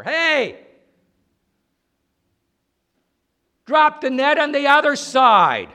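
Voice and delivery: loud call from the shore; shouted call. Acted. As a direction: yell it across the water. Man calling from shore to fishermen.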